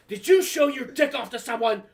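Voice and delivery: high, loud accusing question; outraged mom. Retold or imitated. Mom confronting son after school's call, outraged.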